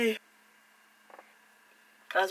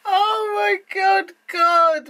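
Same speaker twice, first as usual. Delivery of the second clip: much higher, louder, slow mock crying; mock wail; 11 semitones higher, 6 dB louder, much slower than usual. Seeing her chopped hair, mock-crying loudly. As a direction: wail it like fake sobbing. Horror at her own haircut.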